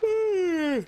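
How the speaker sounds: long exasperated high sigh; loud sigh. Real, not acted. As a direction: let out a big weary sigh. Big sigh during gameplay.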